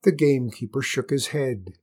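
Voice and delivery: ordinary delivery, nothing marked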